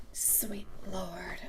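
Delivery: hushed, breath-only aside; soft whispered exclamation; exasperated whisper. Real, not acted. Exasperated aside about the rival.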